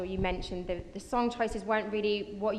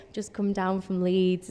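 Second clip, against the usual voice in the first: slow, lightly put-on Leeds accent; attempted Leeds accent; 5 dB louder, slower than usual. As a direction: try a Leeds accent, drawing it out slowly. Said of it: "Is that a good Leeds accent?".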